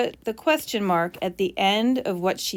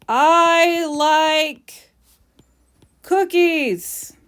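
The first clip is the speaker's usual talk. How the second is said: higher, louder mock yell of a child; 8 semitones higher, 7 dB louder, much slower than usual. Teacher acting out Stan yelling, loud and high. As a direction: belt it out like a kid demanding cookies. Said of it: "He is yelling these words".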